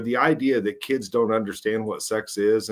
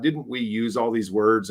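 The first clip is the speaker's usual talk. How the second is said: ordinary talk, nothing marked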